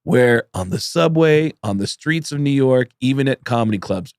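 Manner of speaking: ordinary talk, nothing marked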